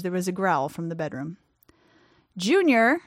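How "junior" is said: calls down the stairs, raised voice; calling down. A narrator's reading. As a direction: call out to someone below. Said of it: "he called down".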